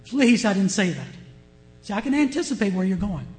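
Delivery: ordinary talk, nothing marked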